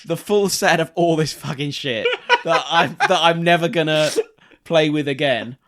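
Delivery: begging mummy for the full set; spoiled brat pleading through a laugh; spoiled posh child. Pretend. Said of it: "they just was like".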